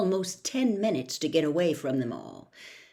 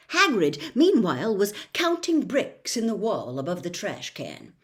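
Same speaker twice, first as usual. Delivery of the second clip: usual speaking voice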